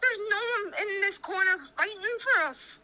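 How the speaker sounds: high-pitched, close to tears; real angry distress. Real, not acted. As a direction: plead angrily, voice climbing toward crying. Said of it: "starting to get angry now".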